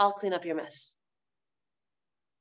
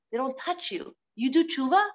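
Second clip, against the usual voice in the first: ordinary talk, nothing marked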